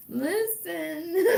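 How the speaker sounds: very high, squeaking through laughter; laughing listen. Real, not acted. Laughing exclamation to audience.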